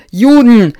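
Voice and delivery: high, loud, sobbing scream. A narrator's reading. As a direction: scream it out in despair. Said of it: "und schrie ihren Namen".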